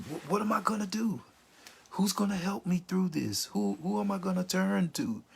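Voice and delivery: high-pitched, anxious pleading questions; acted helpless plea, pitched well up; distressed questioning. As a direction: ask as someone lost in grief. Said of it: "questions you might have found yourself asking".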